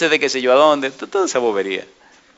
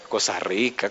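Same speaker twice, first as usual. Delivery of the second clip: much softer and lower aside; hushed aside; 5 semitones lower, 10 dB quieter, slower than usual. Real, not acted. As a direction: quietly savour it between the big questions. Soft aside between loud questions.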